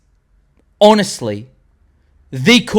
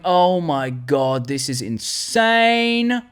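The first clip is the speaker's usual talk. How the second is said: retold awe; 3 dB quieter, much slower than usual. Fan recalls watching in the cinema, retold awe. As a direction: imitate being blown away, stretching every word. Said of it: "I was sitting back going".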